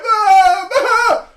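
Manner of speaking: very high, loud crying scream; screaming child. Retold or imitated. Boy screaming at his mom right before she slaps him, very high and loud. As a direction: scream for mom at full tantrum. Tantrum then slap.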